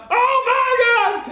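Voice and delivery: very high, loud, drawn-out mock scream; hammed-up freak-out yell; panicked scream. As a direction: shriek it slowly in comic panic. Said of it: "I'm freaking out".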